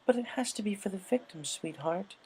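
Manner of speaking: tender but firm objection; Missus Cake. A narrator's reading.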